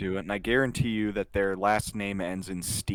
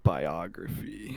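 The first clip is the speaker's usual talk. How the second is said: slow, low supervillain drawl; 6 semitones lower, 6 dB quieter, slower than usual. Pretend. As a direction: say it like an evil mastermind. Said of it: "You sound like a supervillain saying it that way".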